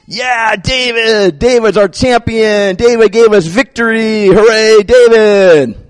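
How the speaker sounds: loud, slow, high cheering crowd; a crowd chanting a hero's name. Retold or imitated. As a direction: cheer for your hero like the whole nation.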